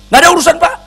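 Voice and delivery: high, loud, furious ranting; angry husband yelling at the pastor in a high, loud voice; fierce angry husband. Retold or imitated. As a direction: tell the pastor to get out, full of rage. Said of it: "Marah dia galak".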